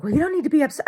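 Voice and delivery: mocking visitor voice. Telling how the Airbnb guests answered, high mocking voice. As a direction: mimic them high and condescending.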